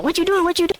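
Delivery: high-pitched, repeated mock protest; imitated protest. Storyteller imitating his homie fighting back, high mock protest. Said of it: "He started fighting back saying".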